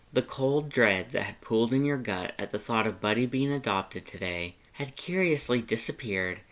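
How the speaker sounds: ordinary delivery, nothing marked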